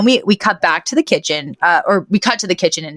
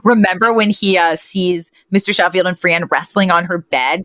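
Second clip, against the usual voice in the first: usual speaking voice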